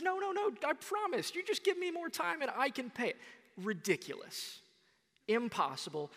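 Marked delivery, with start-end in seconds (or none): 0.0-3.2 high, fast desperate begging; pleading debtor. Acted. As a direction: beg for more time, panicked. Debtor begging the loan shark.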